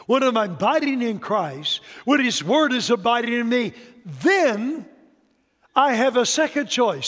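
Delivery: building sermon. Acted. Preacher builds toward the point.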